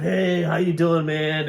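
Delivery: high, loud, drawn-out hello; hearty greeting. Retold or imitated. Actor coming round to hug the co-host, hearty greeting. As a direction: stretch out a big loud greeting.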